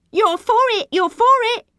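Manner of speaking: high, loud taunting chant; mocking playground shriek; jeering. A narrator's reading. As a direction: jeer it gleefully at your enemy. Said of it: "she jeered".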